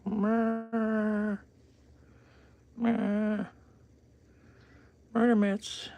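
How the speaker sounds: very high whispered coo about the paws; stuttered high whisper of pet talk. Real, not acted. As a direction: breathe it up high like teasing a kitten. Cooing at cat's paws.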